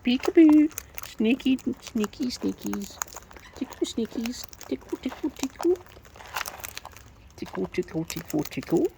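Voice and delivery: put-on high, playful tickle baby talk; giggly tickle attack. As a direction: coo and tease like tickling a baby. Playful tickling game with pet birds.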